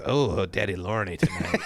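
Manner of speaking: low, slow mock voice; imitated quote. Joking about the producer's words, low slow mock quote. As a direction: sink low and draw it out. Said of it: "Yeah, he goes".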